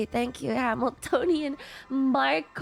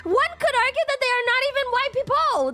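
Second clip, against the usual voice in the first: higher, louder protest; worked up; 9 semitones higher, 3 dB louder than usual. Real, not acted. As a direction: defend yourself, fired up. Heated playful argument with chat.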